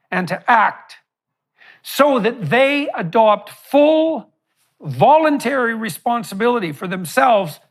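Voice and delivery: deliberate oratory, stretching key words; formal speech. Real, not acted. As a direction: pose a weighty question to an audience. Reads out prepared question.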